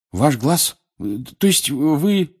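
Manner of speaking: high, halting disbelief; astonished question. A narrator's reading. Harry asks Moody about his eye, astonished. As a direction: falter upward as the question forms.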